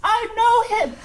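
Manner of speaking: high, loud excited cry; high and loud, thrilled to recognise him; excited recognition. Acted. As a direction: blurt out in surprised recognition. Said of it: "like, goes".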